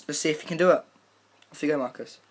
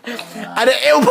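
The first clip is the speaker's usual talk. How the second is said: ordinary talk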